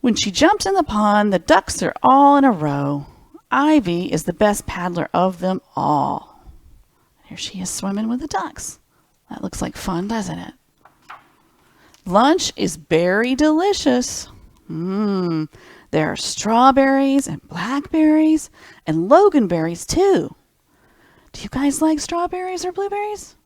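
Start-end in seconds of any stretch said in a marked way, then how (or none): 0.0-6.4 gentle picture-book narration; reading to children. Acted. Reads picture book aloud. 12.0-20.4 lilting picture-book narration; storybook reading. Acted. Reads picture book aloud.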